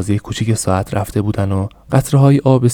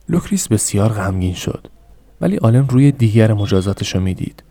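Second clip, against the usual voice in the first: ordinary delivery, nothing marked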